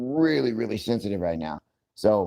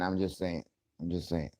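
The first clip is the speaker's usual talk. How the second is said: trailing off; 4 semitones lower, 10 dB quieter than usual. Real, not acted. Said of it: "repeated, fading".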